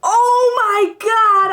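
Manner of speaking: slow, high gasp of shock. Real, not acted. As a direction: gasp it out, stunned. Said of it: "I'm shocked".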